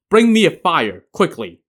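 story character's urgent call; urgent order. A narrator's reading. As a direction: call out for something needed at once.

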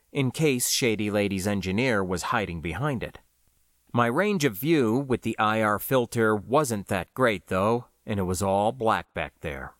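ordinary delivery, nothing marked

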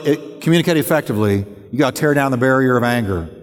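usual speaking voice

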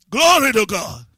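shouted glory; loud exclamation. Real, not acted. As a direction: burst out in praise. Preacher exclaims.

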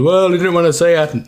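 mate teasing about the haircut, high and loud. Retold or imitated. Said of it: "He goes".

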